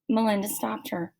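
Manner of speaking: ordinary delivery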